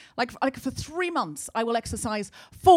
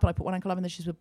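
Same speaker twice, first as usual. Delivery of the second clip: usual speaking voice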